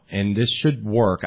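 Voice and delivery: ordinary talk, nothing marked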